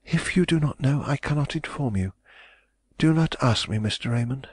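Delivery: high, stern refusal that opens in a whisper; stern young woman. A narrator's reading. Young woman sternly refusing Raymond's question, high and hushed. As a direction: shut the question down coldly. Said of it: "she interrupted me with a stern remark".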